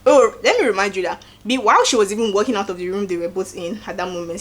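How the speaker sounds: ordinary talk, nothing marked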